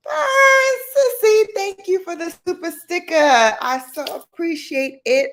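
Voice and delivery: high, loud squealed thanks; gushing thanks. Real, not acted. Host thanks a viewer for a super sticker, gushing. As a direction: burst out high, then gush with gratitude.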